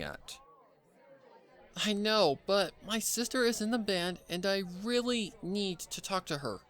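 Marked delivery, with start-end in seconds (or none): 1.7-6.6 high, pleading plea to be let through; voiced pony pleading, not taking no for an answer; begging. A narrator's reading. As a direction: beg the guard to let you see your sister. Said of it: "Pinkie begged".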